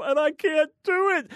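a voice pitched high in despair; troubled believer confessing he can't do it; pleading believer. Retold or imitated. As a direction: cry out that it's impossible, voice jumping up. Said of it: "the person who says".

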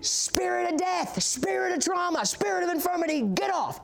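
high, commanding rebuke; deliverance preacher. Retold or imitated. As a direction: imitate a preacher casting out spirits. Imitates man casting out spirits.

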